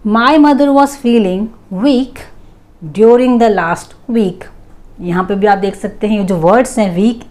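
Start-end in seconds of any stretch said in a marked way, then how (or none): 0.1-4.4 very slow read, a little higher and brighter. Shown as a demonstration. Teacher slowly dictating a new example. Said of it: "Moving on to the next".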